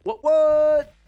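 high, loud hype-man call; mock hype cheer. Host answers his friend's cheer, high loud hype call. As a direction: whoop the words out high and loud.

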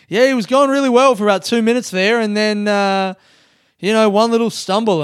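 loud, high mock commentator. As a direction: narrate himself like a sports caller. Narrates himself in third person.

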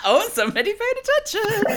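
very high, squealed through laughter; laughing tease. Real, not acted. Host teasing guest for remembering, squealing with laughter. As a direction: tease them while cracking up.

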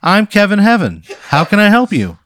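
high, loud, nervous local ad voice; nervous TV pitchman. Acted. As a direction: play an awkward local TV salesman. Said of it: "and he says".

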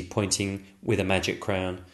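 ordinary delivery, nothing marked

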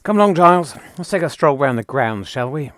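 brisk, bossy Time Lord; posh Doctor voice. Acted. Skit, plays the Doctor.

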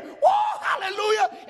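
high, loud hallelujah shout; shouted praise. Real, not acted. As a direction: let the praise burst out.

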